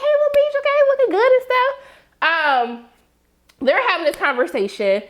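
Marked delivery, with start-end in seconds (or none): 0.0-1.7 high-pitched playful hello, quick at the end; playful high squeal of admiration; flirty greeting. Pretend. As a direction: coo an admiring hey in a very high voice. Playfully calling out to admired actor.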